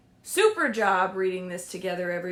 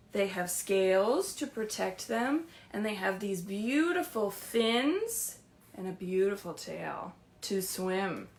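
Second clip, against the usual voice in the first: ordinary delivery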